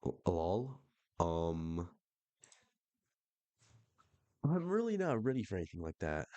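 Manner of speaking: stumble, then push back, thrown; caught off guard. Acted. Widow fends off a sudden advance, caught off guard. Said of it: "she's immediately caught off guard".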